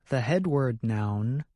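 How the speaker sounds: usual speaking voice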